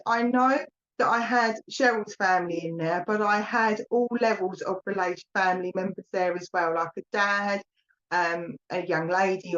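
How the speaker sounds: normal talking voice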